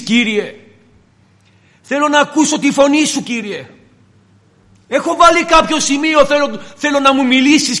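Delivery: pleading prayer. Acted. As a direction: cry out to the Lord with yearning. Voicing a believer's pleading prayer.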